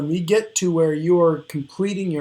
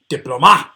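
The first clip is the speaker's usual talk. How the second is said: diploma wrongly stressed as dip-lo-MA, MA loud; stress on last syllable; 9 dB louder, faster than usual. Shown as a demonstration. Teacher offers third option to students, diploma stressed loudly on MA. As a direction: slam the final MA of diploma. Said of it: "with the other two syllable stress possibilities".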